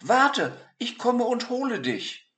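put-on high boy voice calling; eager boy. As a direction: call out like an eager little boy. Narrator voices Peter.

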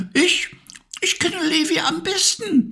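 high, light bee voice; Maya the Bee. A narrator's reading. Narrator voicing Maya the Bee for the toys, high bee voice. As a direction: buzz it out high and bright like a little bee. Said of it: "meinte die Biene Maya".

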